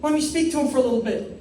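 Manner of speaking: put-on high, delighted old lady voice; excited old Spanish lady. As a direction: gush like a thrilled grandma. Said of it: "because they go".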